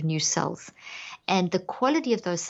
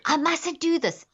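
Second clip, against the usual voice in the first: higher, slow, tense self-scold; mock panicked self-scolding; 9 semitones higher than usual. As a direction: scold yourself in a panic, slowly. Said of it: "let's say that you get mad in traffic".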